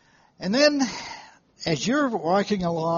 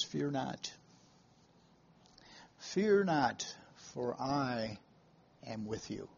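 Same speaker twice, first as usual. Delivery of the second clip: scripture quote; 4 semitones lower, 8 dB quieter than usual. Acted. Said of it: "it says".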